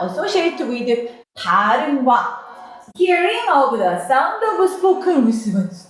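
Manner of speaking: stretched-out reading of English phrase; teacher rereading the sentence slowly for students; slow text reading. Shown as a demonstration. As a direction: read it slowly word by word.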